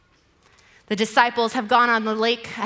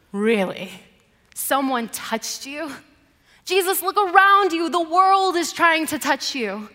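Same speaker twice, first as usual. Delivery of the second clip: exasperated disbelief building louder and higher; 4 semitones higher, 6 dB louder than usual. Acted. Peter to Jesus in the crowd, exasperated. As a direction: start incredulous, then raise your voice in exasperation. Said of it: "it's Peter who is exasperated".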